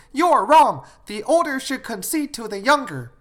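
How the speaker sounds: loud, high-pitched reprimand; scolding parent. Acted. Parent blaming the elder sister.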